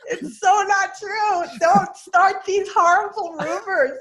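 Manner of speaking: loud, high, slow protest; mock outrage. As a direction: overplay the wounded denial. Mock denial of teasing.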